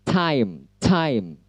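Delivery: "time" with a puffed, hissing T; slow model "time" with aspirated T; aspirated English T. Shown as a demonstration. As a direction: burst of air starting "time".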